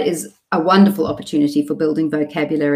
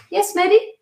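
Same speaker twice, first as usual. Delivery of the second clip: put-on very high, inviting question to a child; train conductor parent; 14 semitones higher than usual. Parent giving one twin her turn, conductor-like. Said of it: "think of myself as the train conductor".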